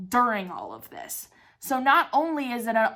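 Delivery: normal talking voice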